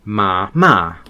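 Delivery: first MA low and level, second MA dropping sharply; low and falling tones. Shown as a demonstration. Learner recalling Chinese tones, low then falling on MA. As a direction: say MA low, then MA with a steep fall. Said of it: "then you have the falling tone".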